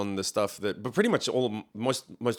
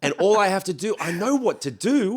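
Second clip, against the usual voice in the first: pumped up; 6 semitones higher than usual. Retold or imitated. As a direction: psych yourself up. Retold inner pep talk.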